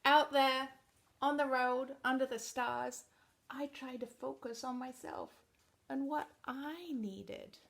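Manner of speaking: picture book narration; reading to children. Acted. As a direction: read it out to a room of kids.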